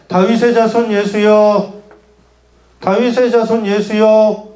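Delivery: slow, level call, held back from shouting; restrained, drawn-out call. Shown as a demonstration. Preacher shows how the blind man did not cry out, slow and restrained. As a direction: call it slowly and evenly, without urgency. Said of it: "이렇게 외쳤을까요?".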